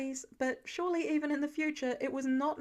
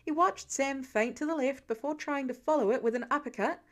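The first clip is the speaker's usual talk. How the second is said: plain reading voice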